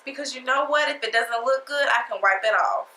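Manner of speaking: ordinary talk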